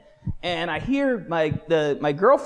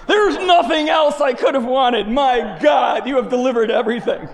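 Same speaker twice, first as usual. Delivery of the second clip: exultant shout; 8 semitones higher, 9 dB louder than usual. Retold or imitated. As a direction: shout it to the heavens, overjoyed. Exulting over his lucky job.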